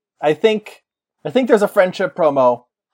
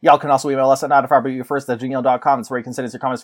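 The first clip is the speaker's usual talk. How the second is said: ordinary talk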